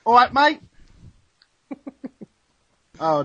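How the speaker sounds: ordinary delivery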